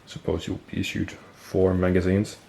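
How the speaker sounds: ordinary delivery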